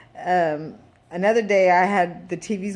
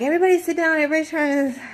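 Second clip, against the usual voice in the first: higher, louder call to sit down; calling the kids; 8 semitones higher, 4 dB louder, much faster than usual. Retold or imitated. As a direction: summon everyone to sit. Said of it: "I'm like".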